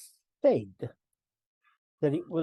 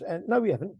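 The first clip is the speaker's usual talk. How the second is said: ordinary delivery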